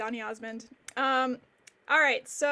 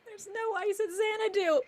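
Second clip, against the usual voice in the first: laughing; 7 semitones higher than usual. Real, not acted. Host laughing at joke.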